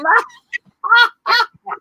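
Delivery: loud, very high belly-out laugh; enormous laugh. Shown as a demonstration. Leader tops the warm-up, enormous laugh. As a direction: laugh right off the scale. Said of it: "an off the screen enormous laugh".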